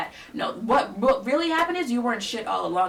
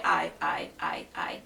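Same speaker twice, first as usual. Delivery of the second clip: trailing whisper; 4 semitones lower, slower than usual. Retold or imitated. Roommate stammering excuses until only a whisper is left. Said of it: "she goes".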